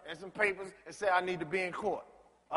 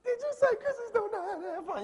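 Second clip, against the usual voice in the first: much higher, mimicking her squeaky outrage with a laugh; mocking mimicry; 14 semitones higher than usual. Mocking Miss Taylor's indignant question back at her, high-pitched. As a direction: throw her words back at her in a silly high voice.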